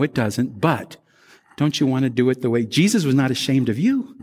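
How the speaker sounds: ordinary talk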